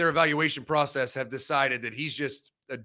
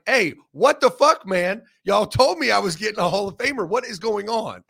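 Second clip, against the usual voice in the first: louder, higher, slowing on the curse; mock outraged rookie; 4 semitones higher, 5 dB louder than usual. Garrett Wilson complaining about the Hall of Famer he was promised, mock outrage. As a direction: play the betrayed receiver venting. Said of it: "Garrett Wilson sitting there like".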